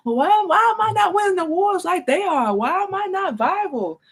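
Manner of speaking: high mock whine about not going viral; whiny old self. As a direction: mimic your old jealous self. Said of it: "We used to".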